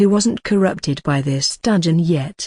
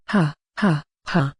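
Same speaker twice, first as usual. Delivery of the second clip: smug hearty laugh; 2 semitones lower, 3 dB quieter than usual. Acted. Narrator gloats over his muscles.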